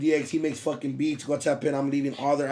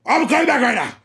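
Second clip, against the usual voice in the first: loud, high-pitched mock phone shout; imitating his friend; 9 semitones higher, 7 dB louder than usual. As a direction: mimic a pal yelling down the phone. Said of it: "This is how he talks. He's like".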